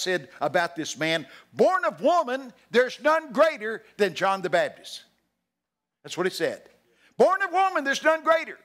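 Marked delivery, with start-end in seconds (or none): 1.5-5.0 high, loud, drawn-out declaration; loud proclamation. Retold or imitated. Preacher quoting Jesus on John, loudly proclaiming. 7.2-8.6 very high, loud preaching cry; forceful proclamation. Retold or imitated. Preacher repeating Jesus' praise of John, forceful proclamation.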